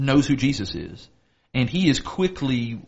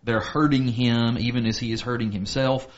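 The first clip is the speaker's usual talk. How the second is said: ordinary talk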